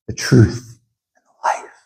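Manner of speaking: whisper. Acted. Closing a solemn scripture quote.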